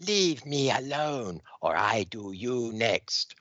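slow, menacing alien threat; villain threat. Acted. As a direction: warn the crowd coldly and slowly. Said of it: "the Dominator goes".

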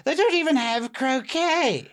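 snooty English, ending shrill; fancy English. Acted. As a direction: build to a mock-appalled fancy English complaint. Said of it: "Is this fancy English".

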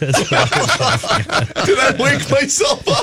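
laughing. Real, not acted. Laughing at own hiccup.

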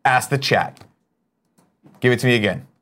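ordinary talk

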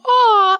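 high-pitched drawn-out coo. Retold or imitated. Passer-by to parents with babies, high imitated coo. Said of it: "I just walk by and I go".

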